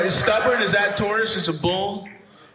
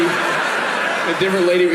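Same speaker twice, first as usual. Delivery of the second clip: slowed, laughing repeat of lady; slower than usual. Real, not acted. Comic repeats his own line slower, amused. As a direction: drag it out, amused.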